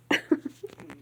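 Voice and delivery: short breathy laugh; suppressed giggle while reading. Real, not acted. Reader breaks up laughing.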